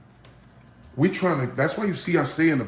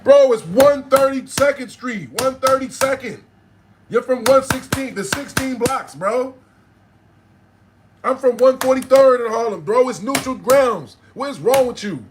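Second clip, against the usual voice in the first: exasperated arguing; 9 semitones higher than usual. Retold or imitated. Arguing that uptown is neutral ground, exasperated and high. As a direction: re-enact pleading outrage, pitched way up. Acts arguing about battle location.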